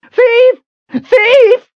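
very high, loud yell; shouting for help. A narrator's reading. Hawker shouts thief to the crowd for help. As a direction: scream it out to the crowd. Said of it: "he shouted for help".

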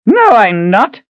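put-on high, sharp old man's retort; snapped. Reuben snapping a denial at Ahern, high and sharp. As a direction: snap back at him. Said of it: "he snapped at last".

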